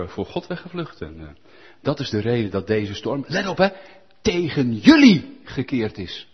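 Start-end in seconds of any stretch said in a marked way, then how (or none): 4.2-6.3 "jullie" punched and drawn out slowly; stress on jullie. Shown as a demonstration. Preacher stressing Jonah's words, "jullie" punched. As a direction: lean hard on "jullie". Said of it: "Hij zegt niet tegen ons, nee, tegen jullie".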